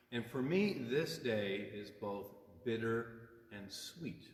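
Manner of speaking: usual speaking voice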